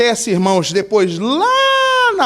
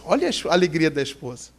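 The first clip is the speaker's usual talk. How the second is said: normal talking voice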